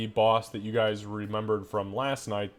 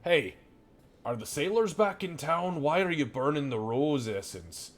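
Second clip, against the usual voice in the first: lightly voiced puzzled butcher, higher on the questions; 4 semitones higher than usual. Butcher walks in on burning rose essence, puzzled. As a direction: sniff out something odd as the butcher.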